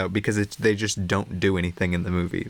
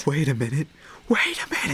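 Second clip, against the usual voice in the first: breathy mock whisper, rising higher; 5 semitones higher, faster than usual. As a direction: whisper it like a secret. Mock-whispered repeat of the quote.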